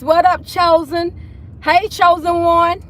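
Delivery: greeting niece Chosen, thrilled and drawn out; excited greeting. Real, not acted. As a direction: sing out a hello to your niece. Greeting her niece joining live.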